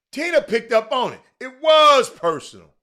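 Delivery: high, loud, WAS stretched out; loud excited agreement. Real, not acted. Host delighted Tina caught the point, loud excited agreement. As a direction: crow it loudly in triumph.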